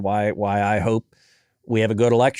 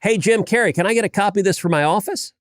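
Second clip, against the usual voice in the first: playful tweet; 9 semitones higher than usual. Retold or imitated. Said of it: "I tweeted the picture out and I said".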